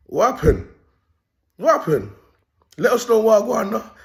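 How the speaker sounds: put-on lightly Jamaican-accented call, high and loud; Jamaican patois. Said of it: "Like my Jamaican people will say".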